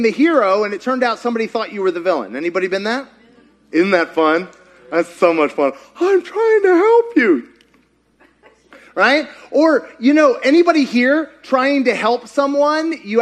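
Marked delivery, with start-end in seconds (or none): 6.0-7.5 exasperated whine. Acted. Acting exasperated helper.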